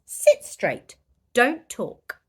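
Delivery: stern teacher. Acted. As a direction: bark at the class. Teacher scolds class.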